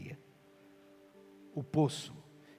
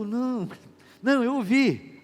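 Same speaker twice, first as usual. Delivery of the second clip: flustered denial; 7 semitones higher, 8 dB louder, slower than usual. Retold or imitated. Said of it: "Aí você falou".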